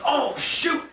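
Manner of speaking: a sudden high yelp of alarm; startled exclamation. Retold or imitated. Driver spots the cop and yelps in alarm. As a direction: jump up in pitch like you just spotted the cop. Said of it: "He goes".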